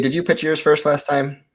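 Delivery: normal talking voice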